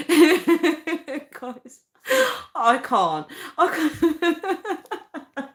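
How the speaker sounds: breaking into high giggles; can't get the words out for laughing. Real, not acted. Telling viewers about UK dating, cracking up.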